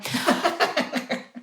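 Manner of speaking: high, breathy run of laughter. Real, not acted. Laughing over her packed schedule, high breathy chuckles. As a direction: burst out in a string of quick chuckles.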